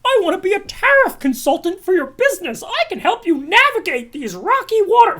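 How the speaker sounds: squeaky fake-friendly sales pitch; mock salesman voice. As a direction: parody a pushy consultant's cold email in a high fake-friendly voice. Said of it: "people saying".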